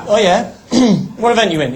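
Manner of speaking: a mock Geordie security man, loud and casual; Geordie accent. Newcastle guard asking the fake athlete, loud mock Geordie accent. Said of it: "the security were from Newcastle as well".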